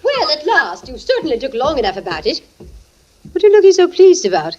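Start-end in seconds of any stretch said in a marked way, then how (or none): 3.3-4.6 sharp, higher and louder, a suspicious question; surprised. Acted. Wife suspiciously asks her husband why he looks so pleased. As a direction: pounce on his good mood.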